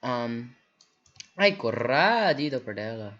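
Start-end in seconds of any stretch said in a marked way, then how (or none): 1.4-3.1 bad Spanish accent. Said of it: "That was really bad accent".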